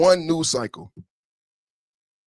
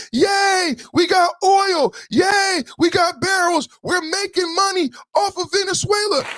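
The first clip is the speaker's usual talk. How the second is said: mock celebration; 13 semitones higher than usual. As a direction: cheer it up like a smug party. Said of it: "You hear it as a celebration".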